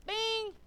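sudden high-pitched "bing"; light-bulb chime done by voice; high imitated ding. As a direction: ring it out like a bell. Imitates a sudden realization going off.

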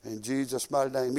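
ordinary talk

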